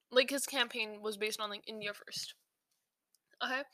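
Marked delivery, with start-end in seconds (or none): 1.5-2.3 whispering. Retold or imitated. Whispered aside on slogan.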